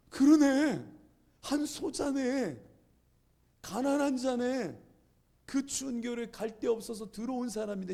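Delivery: high, drawn-out exclamations; dawning realization. Retold or imitated. Student realizing the stranger was the least one, struck. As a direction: let each realization land, slow and high.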